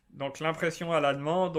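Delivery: ordinary talk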